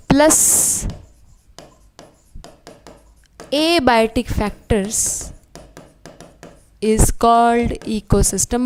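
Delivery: each term set apart with long pauses; slow, weighted recap of a definition; teacher drilling the definition, very slowly; slow dictation. Shown as a demonstration. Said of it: "समझा हो definition सब चीज़ों का?".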